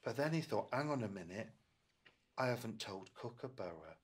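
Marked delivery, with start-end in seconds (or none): none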